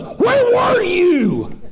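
high, slow, drawn-out mock demand; roommate's hammed-up indignant yell; accusing shout. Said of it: "Like it was my fault".

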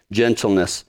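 ordinary talk

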